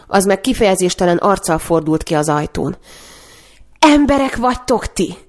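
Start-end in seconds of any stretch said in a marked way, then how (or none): 3.8-5.2 horrified cry. A narrator's reading.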